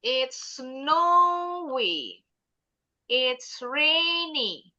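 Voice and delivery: "It's snowy", "It's rainy" drawn out slowly; very slow model. Shown as a demonstration. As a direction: read each weather word for children to copy. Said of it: "Now you try".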